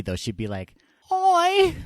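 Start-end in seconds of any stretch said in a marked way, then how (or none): 1.1-1.7 loud, high mock Cockney greeting; hammed-up, high-pitched Cockney. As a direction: yelp it in a bad Cockney accent. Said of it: "[name] has a Cockney accent".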